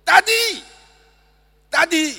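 put-on very high, loud child's cries; boy calling daddy. Son collapsing calls his daddy in very high cries. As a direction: call out like a collapsing little boy. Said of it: "He said".

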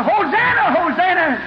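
high, exultant crowd cry; hollering. Acted. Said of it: "Others hollering".

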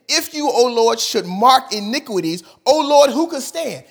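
high, rising cry to God; fervent psalm reading. Acted. Preacher reading a psalm of forgiveness, fervently pleading. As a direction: plead it out like a prayer for mercy.

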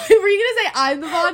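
high, loud, laughing question; laughing through the words. Real, not acted. Teasing friend bursts out laughing.

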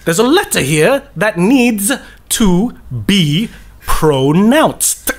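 slow, over-crisp stage diction; drawn out, each word sharply separated; theatre-trained over-enunciation. Acted. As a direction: articulate every letter like a trained stage actor. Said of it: "ask a theatre trained actor".

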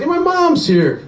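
put-on girlfriend's alarmed voice. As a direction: squeak out the warning that mom is there.

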